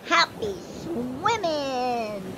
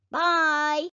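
normal talking voice

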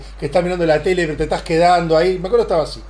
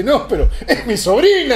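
laughing while quoting, higher and louder; quoting through laughter; 6 semitones higher, 5 dB louder than usual. Retold or imitated. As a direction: laugh as you deliver the punchline.

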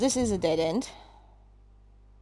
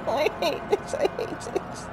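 high screamed panic breaking into sobbing laughter; scream, then dissolve into wailing hysterics; real hysterics; 10 semitones higher, much slower than usual. Real, not acted. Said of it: "okay, hysteric's over".

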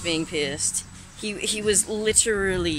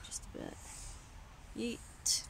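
soft unvoiced whisper; 4 semitones higher, 16 dB quieter than usual. Real, not acted. Whispering outdoors at night.

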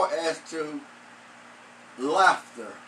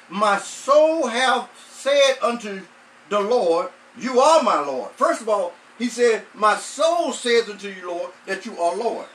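rising, louder preacher's cadence; preaching; 2 semitones higher, 5 dB louder than usual. Acted. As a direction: drive the verse home to the congregation.

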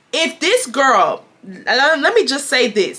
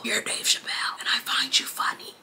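hushed, voiceless whisper; mock whisper; 12 dB quieter than usual. Taunting the angry girl about her comedy show, mock whisper. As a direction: whisper it with a gasp. Mock aside after the rant.

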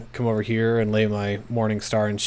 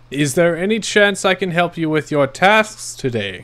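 put-on higher, louder repeat for a deaf old man; raised-voice repeat; 7 semitones higher, 6 dB louder than usual. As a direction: say it again, louder, so he hears. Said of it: "What's that?".

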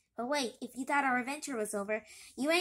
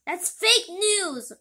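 loud, high, slow indignant cry; 7 semitones higher, 10 dB louder, much slower than usual. Acted. Character protests the claim, loud and slow. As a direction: protest loudly, drawing it out.

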